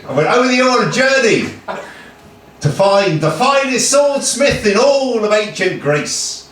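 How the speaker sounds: projected mock-epic declamation; grand storytelling. Achilles recounting his journey to the swordsmith, grand storytelling. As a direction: declaim it like a legend, drawing out the ending.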